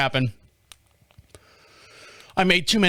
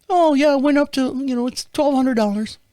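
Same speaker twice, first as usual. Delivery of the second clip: put-on high, girlish rep voice; little girl on phone; 8 semitones higher than usual. Bank rep asking caller to verify, high little-girl voice. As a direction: rattle off verification in a girlish pitch. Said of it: "I get this little girl on the phone".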